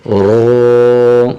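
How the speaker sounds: رو said thick with rounded lips, not a thin را; full rounded رو; heavy ro. Shown as a demonstration. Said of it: "یہ رو کو بھی موٹا کر کے پڑھیں گے".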